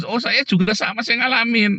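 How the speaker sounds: high, gushing imitation of a churchgoer; mocking high voice of a know-it-all believer; mock eager churchgoer. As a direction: parody someone eager to say it happened to them too. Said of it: "Ngomongnya tentang Tuhan, terus".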